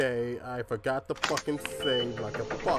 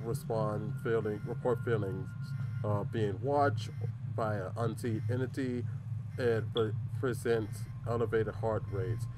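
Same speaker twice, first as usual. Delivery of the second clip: reading a document; 5 semitones lower, 7 dB quieter than usual. Acted. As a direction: read the file aloud, hushed. Reads in-game document aloud.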